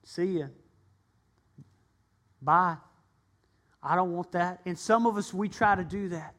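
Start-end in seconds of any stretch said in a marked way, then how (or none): 0.0-4.6 father sending the flippant son away; mild dismissive father. Acted. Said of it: "The father would have said".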